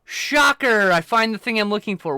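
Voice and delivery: loud, high sarcastic announcement; mock-dramatic reveal of the obvious; sarcastic shocker. Real, not acted. Streamer to chat, loudly sarcastic.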